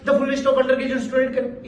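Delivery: words run together and smudged, a wrong example; mock the rushed blurry read; blurred rushed demo. Shown as a demonstration. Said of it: "मैं ऐसे बोल सकता था". (जल्दबाज़ी में धुंधले शब्द, गलत तरीका)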